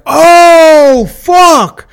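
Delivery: high, drawn-out, loud mock exclamation; long high "ohhh" of alarm, hammed-up; mimicked crowd cry. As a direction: imitate a whole bar reacting in shock. Retold crowd reacting to glass breaking.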